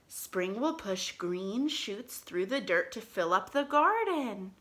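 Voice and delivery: normal talking voice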